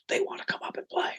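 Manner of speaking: whispered onstage. Retold or imitated. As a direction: hiss it urgently from the side of the stage. Guitar tech whispering onstage.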